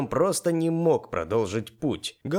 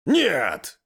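raised, higher shout back; shouted reply; 5 semitones higher, 5 dB louder than usual. A narrator's reading. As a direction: shout it back to someone out of sight. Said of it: "крикнул я ей в ответ". (крикнув в ответ)

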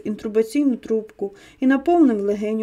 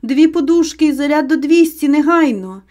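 louder, higher emergency order; urgent shout; 4 semitones higher, 6 dB louder than usual. A narrator's reading. Husband yelling orders to paramedic over his wife, urgent shout. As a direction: shout it as a command that cannot wait. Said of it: "Я закричав".

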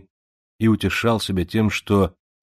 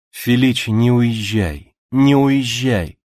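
desperate plea; 5 semitones higher, 5 dB louder, slower than usual. Acted. Zita begging on her knees.

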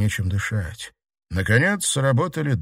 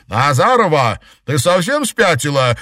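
high, loud, outraged yell; shouted; 6 semitones higher, 7 dB louder than usual. A narrator's reading. Girl shouting at her rampaging classmate, outraged yell. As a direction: yell at someone who has gone mad. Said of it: "крикнула Ирина".

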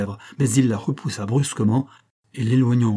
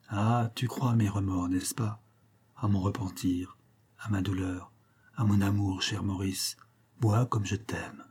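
murmuring in his ear; 2 semitones lower, 7 dB quieter than usual. A narrator's reading. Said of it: "lui murmurait à l'oreille". (murmure doux à l'oreille)

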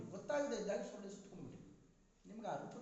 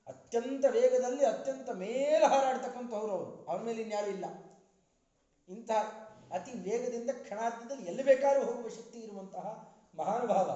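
booming, much louder praise of Garuda; full-force preaching; 3 semitones higher, 12 dB louder than usual. Acted. Preacher extolling Garuda to the audience, booming at full force. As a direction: proclaim it at the top of your voice to the hall.

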